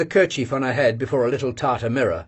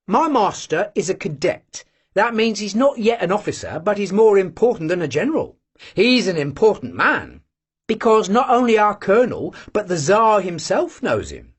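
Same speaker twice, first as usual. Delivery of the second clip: proud; 7 semitones higher than usual. A narrator's reading. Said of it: "proudly explained Vanyusha".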